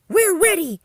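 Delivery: very high, loud cry; eager calling out. A narrator's reading. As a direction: call out ready for the rescue. Rescue engines answer emergency call.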